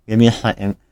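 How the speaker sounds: ordinary talk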